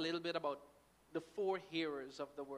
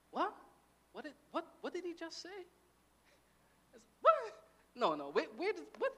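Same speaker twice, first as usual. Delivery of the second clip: mock bafflement; 10 semitones higher than usual. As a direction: act totally lost, questions rising sharply. Acts out baffled Bible reader.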